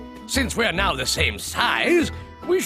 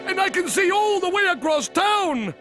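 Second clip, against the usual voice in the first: excited; 5 semitones higher than usual. Acted. Excited shout.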